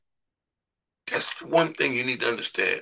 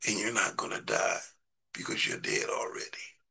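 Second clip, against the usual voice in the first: hushed aside; 2 semitones lower, 8 dB quieter than usual. Real, not acted. Quiet aside after building point.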